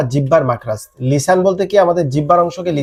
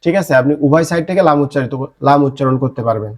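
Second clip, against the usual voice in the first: ordinary delivery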